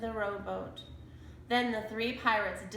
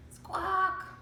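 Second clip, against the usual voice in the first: high squawking bird cry; parrot squawk; 10 semitones higher than usual. A narrator's reading. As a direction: screech like a parrot. Parrot cries out.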